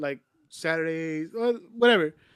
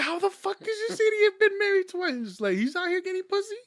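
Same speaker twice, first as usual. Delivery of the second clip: much higher, louder disbelief; incredulous; 6 dB louder than usual. Retold or imitated. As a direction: retell your shock in mock outrage. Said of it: "I was just like".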